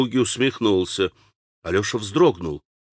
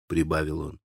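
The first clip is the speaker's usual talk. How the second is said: ordinary delivery, nothing marked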